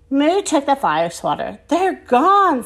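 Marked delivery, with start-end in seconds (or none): none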